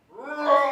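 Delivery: loud, strained mock lion roar; daddy lion roar. Daddy lion roaring at his lost cub, loud mock roar. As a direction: let out the daddy lion's call. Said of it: "the daddy lion said".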